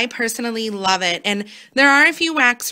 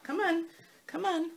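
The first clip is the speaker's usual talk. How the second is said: high, soft coaxing to a cat; coaxing pet voice; 6 semitones higher, 11 dB quieter than usual. Real, not acted. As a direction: gently call the cat up, higher and softer. Coaxing her cat to come up.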